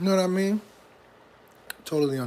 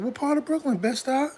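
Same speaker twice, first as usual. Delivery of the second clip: eager local; 11 semitones higher than usual. Retold or imitated. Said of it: "They be like".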